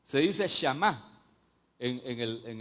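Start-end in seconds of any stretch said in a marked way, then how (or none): 0.0-1.2 shamá drawn out, stress on the final má; Hebrew word shamá. Shown as a demonstration. Teaching the Hebrew word: shamá spoken slowly. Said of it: "la palabra oír se dice".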